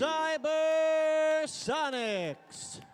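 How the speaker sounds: slow, loud, stretched-out name; hype team announcement. Real, not acted. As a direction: boom it out to the arena.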